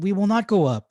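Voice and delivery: high, loud, dug-in refusal; stubborn refusal. Acted. The men refusing Joshua, high, loud and set. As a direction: refuse flatly, hearts set. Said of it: "they're set in their way".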